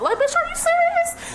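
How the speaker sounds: very high-pitched mock disbelief; incredulous comeback. Worker reacting to coworkers' ridicule, incredulous. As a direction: pitch shoots up in amused outrage.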